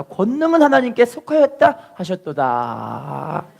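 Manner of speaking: slow, spaced recitation to be repeated; slow call-and-response. Shown as a demonstration. Pastor leading the congregation in reciting, slow and deliberate. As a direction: lead the verse slowly for the church to follow. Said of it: "따라합시다".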